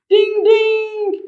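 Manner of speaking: very high chiming ding; bike bell. Acted. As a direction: ring it like a bicycle bell. Bike bell as Bigfoot rides.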